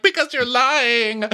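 high, drawn-out accusing cry; mock angry. [name] accusing Drew of lying, mock angry. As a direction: hurl it back in hammed-up anger. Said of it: "You're so angry".

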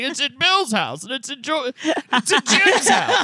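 put-on high, slow quavering old man voice, laughing; a high laughing mock old man; doddering old man. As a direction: play a confused old judge losing track. Old judge voice continues.

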